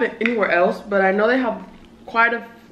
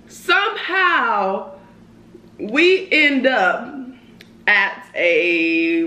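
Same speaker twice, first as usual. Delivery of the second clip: slow suspenseful; much slower than usual. Real, not acted. Suspense build up.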